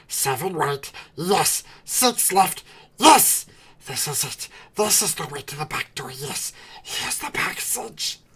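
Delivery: excited creature muttering, leaps up on yes; Gollum voice. Acted. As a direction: Gollum counting his way out. Gollum counting passages.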